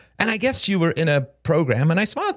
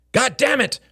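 a sudden frustrated outburst, higher; sudden shout; 8 semitones higher than usual. A narrator's reading. Regina breaking off her apology to Shane with a sudden shout. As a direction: burst out in frustration. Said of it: "she suddenly shouted".

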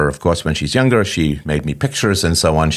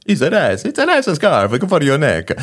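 high-pitched, hammed-up Italian accent; mock Italian accent; 9 semitones higher than usual. Husband imitating his wife praising a scarf, mock Italian accent. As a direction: lay on a bad, singsong Italian accent. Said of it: "I don't know why she's not Italian".

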